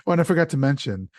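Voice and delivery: ordinary talk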